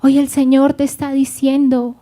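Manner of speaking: usual speaking voice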